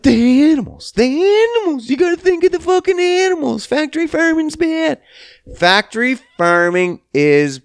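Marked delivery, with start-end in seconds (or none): 0.0-5.0 high, fast, whiny sing-song parody of a preachy vegan; a hammed-up high voice mocking vegans fussing about the animals; mocking vegan whine. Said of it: "They're like".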